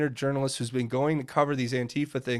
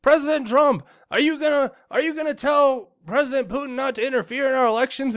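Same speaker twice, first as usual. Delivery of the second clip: reporter yelling out; 9 semitones higher than usual. Retold or imitated. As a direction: call it out over the room to the president. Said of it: "one of the reporters yells out".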